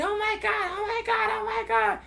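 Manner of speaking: very high, squealed imitation of panic; hammed-up frantic wailing; mock hysterics. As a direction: imitate a passenger having a fit. Imitating passenger's hysterics while kicking seat.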